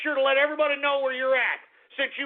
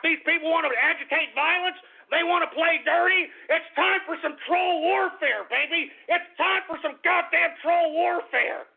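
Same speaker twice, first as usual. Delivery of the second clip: angry; 7 semitones higher than usual. Real, not acted. Declaring troll warfare.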